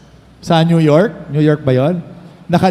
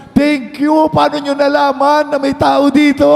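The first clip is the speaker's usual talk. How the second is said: high-pitched, hammed-up grateful cry; overjoyed relief; 9 semitones higher than usual. Castaway to his rescuers, hammed-up overjoyed relief.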